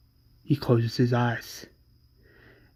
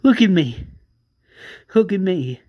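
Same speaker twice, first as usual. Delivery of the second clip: urgent plea; 4 semitones higher, 6 dB louder than usual. Acted. Tara pleads with dying Felipe.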